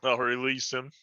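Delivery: ordinary talk, nothing marked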